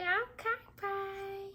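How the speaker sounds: put-on high, soft little baby voice; coaxing baby voice; baby's voice. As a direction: speak for the baby in a tiny high voice.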